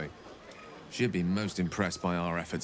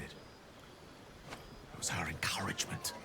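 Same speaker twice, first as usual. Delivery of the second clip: slow, much softer, voiceless near-whisper; 10 dB quieter, much slower than usual. Acted. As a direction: drop to a tender whisper of gratitude. Wizard recalls companion tenderly.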